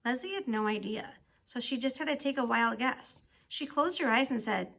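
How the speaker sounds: plain reading voice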